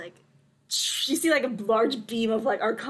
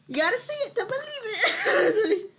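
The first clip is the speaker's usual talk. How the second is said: high, drawn-out silly delivery; playful high voice; 12 semitones higher, slower than usual. Acted. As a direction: stretch it out high, about to laugh. Playful quoted line, laughing after.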